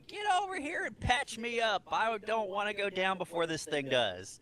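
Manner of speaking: high, barking drill sergeant orders. Acted. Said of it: "in her best, uh, drill sergeant, uh, voice".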